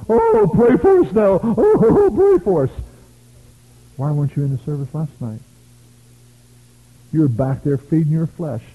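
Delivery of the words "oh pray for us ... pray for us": high, loud, slow hammed-up begging; mock plea from the pastors, laughing; mock pleading pastors. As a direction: whine like desperate pastors, half laughing. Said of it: "All the pastors went".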